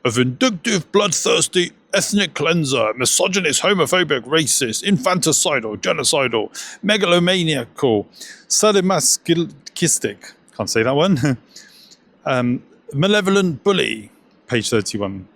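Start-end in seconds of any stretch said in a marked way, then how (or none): 0.0-10.3 higher-pitched, put-on English academic voice; hammed-up quotation in a raised voice; Richard Dawkins impression. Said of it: "Dawkins famously calls God". 12.9-14.1 put-on academic voice, pitched high; hammed-up, high English quoting voice; Richard Dawkins impression. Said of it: "Dawkins famously calls God".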